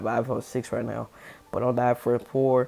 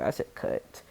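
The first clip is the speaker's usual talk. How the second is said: whispered, unvoiced "I said cut"; soft whisper of the lie; 7 dB quieter than usual. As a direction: breathe it out like a secret. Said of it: "I was like".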